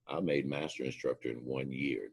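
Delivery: ordinary talk, nothing marked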